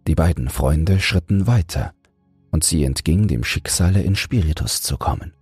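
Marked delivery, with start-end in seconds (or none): none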